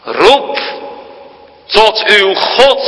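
shipmaster's call. Acted. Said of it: "zei die opperschipper".

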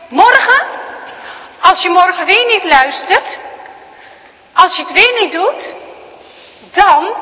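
loud, high, seething grandpa; furious threat. Acted. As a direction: threaten her as he storms off. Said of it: "sist hij".

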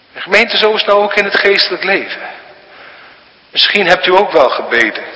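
fast, high, loud sermon voice; fervent preaching. Acted. Preacher urging the congregation about prayer, fervent and fast. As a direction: drive it home to the congregation.

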